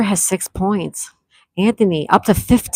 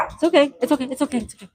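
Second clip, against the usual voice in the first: soothing hush; 7 semitones higher, 4 dB quieter, much faster than usual. Real, not acted. Hushing someone off-mic mid-show.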